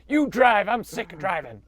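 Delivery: high, loud, whining complaint; high whiny voice. Acted. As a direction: whine like a spoiled rider. Voicing the other rider back.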